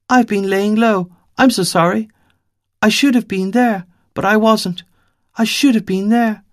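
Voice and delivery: put-on high, small girl, apologetic and pleading; a little girl apologising, eager and remorseful; young girl's voice. Little girl apologising to Five, high and contrite.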